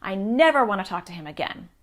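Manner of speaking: put-on loud, fed-up outburst from an upset kid; furious child. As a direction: blurt it out angry at a friend.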